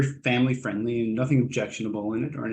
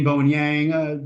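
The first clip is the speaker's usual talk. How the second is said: ordinary talk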